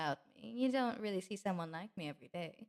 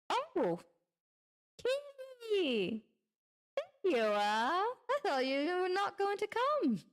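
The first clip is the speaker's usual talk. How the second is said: high squeal, loud and drawn out; delighted greeting; 10 semitones higher, 4 dB louder, much slower than usual. Acted. Greeting the kitty they thought would not come, excited squeal. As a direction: gush at a long-awaited arrival. Spots expected kitty arriving.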